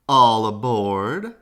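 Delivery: loud, slow call. A narrator's reading. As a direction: call it out like a captain. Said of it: "Oscar called".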